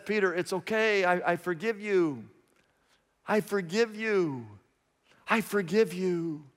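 Jesus comforting the guilty disciple, softly; tender forgiveness. Retold or imitated. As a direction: lay a hand on his shoulder with your voice. Jesus comforting kneeling Peter.

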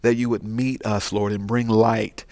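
bow your voice before God; prayer. Acted. Pastor leads the prayer.

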